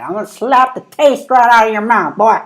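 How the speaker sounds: loud, high-pitched mock threat; threatening woman. Imitating woman who comes out of nowhere, mock threat. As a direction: yell it high like an outraged woman. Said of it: "that woman just comes out of nowhere like".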